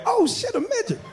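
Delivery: high startled squeal; sudden high-pitched gasp of surprise; mock surprise. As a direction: squeak it out in shock at the sight. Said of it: "you're like".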